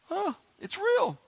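high, slow wonder; high amazed realization. Retold or imitated. New believer realizing the baptism is real, amazed. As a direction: let the amazement dawn slowly.

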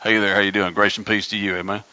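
lazy dismissive church greeting; mock casual greeting. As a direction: toss it off like a casual hello. Mocking a casual throwaway greeting.

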